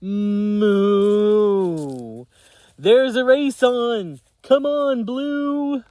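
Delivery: high, loud cheering moo; cow crying out. Acted. Cow cheers on Little Blue, high and loud. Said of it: "cow cried".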